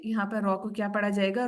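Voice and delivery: normal talking voice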